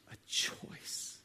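hushed whispered choice. Real, not acted. Preacher whispering the moment he chose God. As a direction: drop to a whisper on the key word. Sermon climax of decision.

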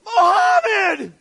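very loud, high drawn-out shout; mock yell. Hammering a nail, slipping, yelling the name in pain. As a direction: yell it like you just hit your thumb. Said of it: "what do you yell?".

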